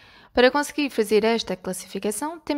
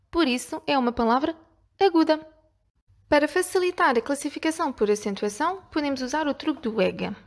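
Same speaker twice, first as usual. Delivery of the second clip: ordinary talk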